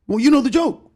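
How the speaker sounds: high, insistent mock retort; high put-on voice, pressing the point; offended heckler. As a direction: imitate an aggrieved complainer answering back. Heckler's reply in acted dialogue.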